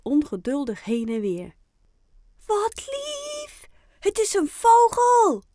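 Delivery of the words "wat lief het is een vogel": very high, excited squeal building to loud; delighted call. A narrator's reading. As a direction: squeal over a cute surprise. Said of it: "roept Fien". (verrukt uitgeroepen)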